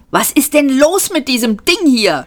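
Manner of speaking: high-pitched, exasperated outburst; scolding. A narrator's reading. Passenger rants at a lift that won't move, scolding. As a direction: rail at the useless machine. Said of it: "schimpfte er".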